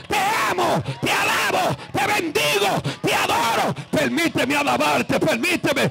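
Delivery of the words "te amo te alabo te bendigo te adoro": high, shouted bursts of adoration; exultant praise. Real, not acted. Worshipper praising when Christ comes, exultant shouting. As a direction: fire off each phrase as a high cry.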